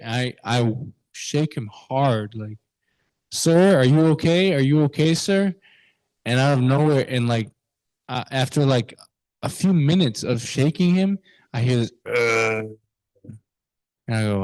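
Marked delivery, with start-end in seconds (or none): 3.3-5.5 urgent, higher-pitched check; louder, insistent retold call; calling to rouse. Teen shaking an unconscious stranger in the cold, calling to rouse. 12.1-12.7 imitated groan of someone barely conscious; drunk groan. Unconscious drunk man stirring when shaken, imitated groan.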